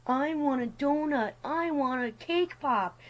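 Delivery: mock a toddler begging for treats; whiny kid. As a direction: teller mimics a kid whining for sweets. Whining kid quoted by teller.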